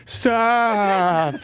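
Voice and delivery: a rising, frightened "Stop". Retold or imitated. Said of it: "now all of a sudden it's".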